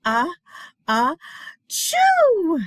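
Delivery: building ah-ah then loud high choo; big sneeze. Acted. Bear sneezing from pepper, loud building sneeze. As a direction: wind up and burst into a sneeze.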